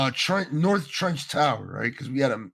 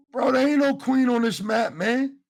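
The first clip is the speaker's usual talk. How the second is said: high-pitched retold protest; baffled objection, pitched well up; incredulous; 9 semitones higher than usual. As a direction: push back in high, mock-puzzled disbelief. Retells incredulous reply to strangers.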